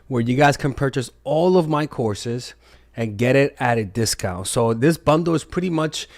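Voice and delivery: steady sales pitch, some words slowed right down; ad read. Acted. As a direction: pitch the bundle like a sponsor spot. Reads course bundle ad.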